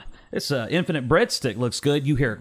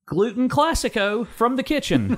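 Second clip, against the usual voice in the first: higher waitress voice; 11 semitones higher than usual. Acted. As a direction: announce it like a waitress.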